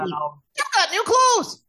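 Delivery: high, slow squeal of admiration; thrilled high-pitched little sister; admiring gnome. Acted. Gnome admires brother's new clothes.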